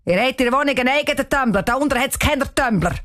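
scolding caretaker. A narrator's reading. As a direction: rattle off a reprimand to children. Caretaker drives girls out, scolding. (gschumpfe wien en Huswart)

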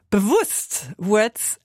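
ordinary delivery

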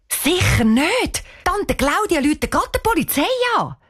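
high, indignant protest; vehement refusal. Acted. Cheyenne refusing to tell Tante Claudia, vehement. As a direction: stretch the refusal, then rush the reason.

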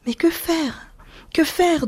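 husband's doleful plea; plaintive. A narrator's reading. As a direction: ask weakly, lost and pleading. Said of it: "d'une voix dolente".

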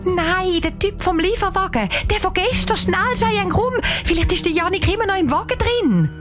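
very high, urgent outburst; excited. A narrator's reading. Anisa urging Cheyenne to hurry to the van, excited. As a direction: urge your friend on, pitched right up. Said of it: "sagt aufgeregt".

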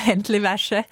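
schwäbelnd, spielerisch; Dialekt. Retold or imitated. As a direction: sag es wie ein Schwabe zu den Kindern. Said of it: "Ich sag halt immer". (schwäbisch mundartlich gesprochen)

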